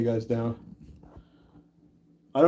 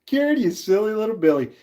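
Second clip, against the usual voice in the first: a higher, singsong tease; playful teasing; 7 semitones higher than usual. Real, not acted. Teasing chat viewer Karen for a funny comment, singsong. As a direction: rib her affectionately, pitched up.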